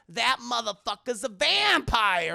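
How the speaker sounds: loud, high, slow punchline; mock declaration. Van Helsing explains the reflection, hammed-up loud verdict. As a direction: stretch the last word loudly.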